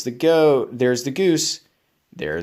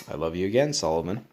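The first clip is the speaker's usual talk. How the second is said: tender murmur; 5 semitones lower, 6 dB quieter, much faster than usual. Real, not acted. Parent tucking child in.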